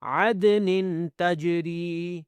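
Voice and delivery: تجری with a long final "ree"; slow tajweed reading of تجری; elongated ra. Shown as a demonstration. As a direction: chant عدن تجری slowly, holding the ending. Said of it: "را کو بھی کھینچنا ہے".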